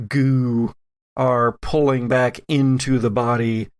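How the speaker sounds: ordinary talk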